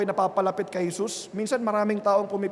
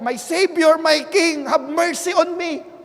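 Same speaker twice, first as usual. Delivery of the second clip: higher, louder pleading call; crying out; 6 semitones higher, 3 dB louder, slower than usual. Retold or imitated. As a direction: plead loudly for mercy. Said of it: "ano pong sinasabi niya".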